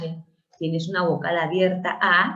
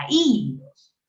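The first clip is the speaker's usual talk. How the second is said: Hilos with a louder, drawn-out first syllable, HIII-los; stress on the í; 2 semitones higher, 3 dB louder than usual. Shown as a demonstration. Teacher showing students the accented vowel, stress on HI in Hilos. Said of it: "el acento está en la cerrada".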